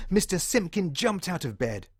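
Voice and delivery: ordinary delivery, nothing marked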